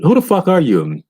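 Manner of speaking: high startled demand; baffled outburst. Retold or imitated. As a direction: fling it up high, bewildered. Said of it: "You're like".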